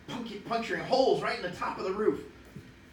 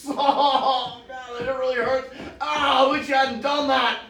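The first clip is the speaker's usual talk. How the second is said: mock pain; 7 semitones higher, 6 dB louder, slower than usual. Lion hurting at river.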